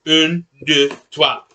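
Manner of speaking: high-school French counting, "Un, deux, trois"; French pronunciation. Shown as a demonstration. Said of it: "I learned French in high school".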